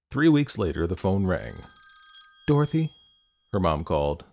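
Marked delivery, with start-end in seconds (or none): 2.5-2.9 high, hesitant questioning call; a bit reticent. A narrator's reading. Mom calling daughter to the phone, a bit reticent. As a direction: call the name tentatively, pitched up. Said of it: "sounding a bit reticent".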